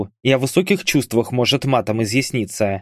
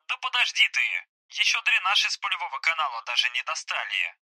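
soft, higher, thin phone-filtered voice; voice on speakerphone; 5 semitones higher, 8 dB quieter than usual. Acted. Hospitalised soldier reporting to his comrades, telephone voice. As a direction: talk as if coming through a phone speaker. Bars heard over speakerphone.

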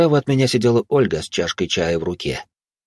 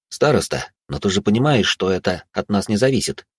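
usual speaking voice

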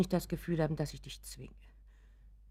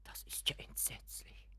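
shocked whisper; 16 dB quieter than usual. Acted. Mother hearing of stolen money, appalled whisper. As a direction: breathe it out in dismay.

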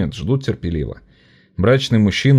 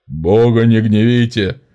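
slow, booming bass shout; shouting over a crowd; 2 semitones higher, 4 dB louder, slower than usual. A narrator's reading. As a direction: drown out the crowd. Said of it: "перекрикивая надтреснутым басом". (надтреснутый бас)

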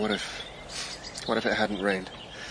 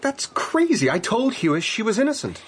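higher and louder, protesting hard; indignant disbelief; 10 semitones higher, 3 dB louder than usual. Acted. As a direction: jump up in pitch, shocked and defensive. Outburst defending Hester's innocence.

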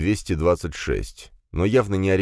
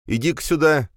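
sharp angry call; 9 semitones higher than usual. Acted. Brother grabbed, pulled out.

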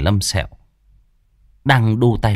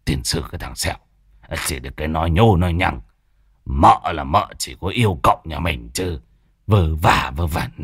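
put-on coy old man; 5 semitones lower than usual. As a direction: shrug it off, secretly pleased. Embarrassed Phọt grumbles.

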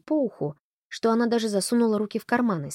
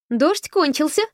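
much higher, louder happy call; cheerful exclamation; 9 semitones higher, 7 dB louder, slower than usual. A narrator's reading. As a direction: burst in full of cheer. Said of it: "воскликнула та весело".

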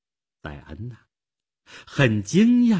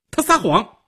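high, loud outburst; shouted accusation; 12 semitones higher, 5 dB louder than usual. Acted. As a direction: cry out the accusation sharply. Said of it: "她喝道".

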